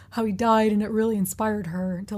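lightly put-on imitation of her voice; mocking her voice. Telling her uncle story, making fun of her voice. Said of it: "now I'm making fun of her voice".

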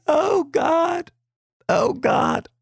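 a stunned gasp of horror; shocked. Retold or imitated. Teacher watching kids argue, high shocked oh god. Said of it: "I'm like".

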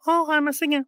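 high, naive singer impression. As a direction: announce yourself in a high voice.

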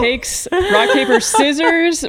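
sports announcer. Pretend. Mock play-by-play of game result.

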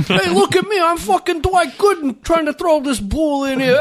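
hammed-up East Coast accent. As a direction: brag like a Jersey guy pitching a ball. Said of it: "everybody from the East Coast sounds".

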